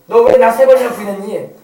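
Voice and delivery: loud, mock accusing interrogation; scolding. Jesus imagined confronting Peter over his denial, scolding. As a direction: dress down a shameless deserter.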